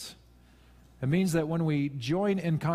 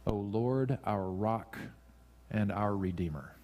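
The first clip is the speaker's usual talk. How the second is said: soft prayerful recital; 4 semitones lower, 6 dB quieter, slower than usual. Shown as a demonstration. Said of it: "if that is our tone".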